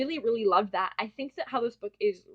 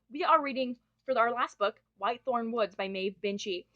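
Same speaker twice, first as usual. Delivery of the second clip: ordinary talk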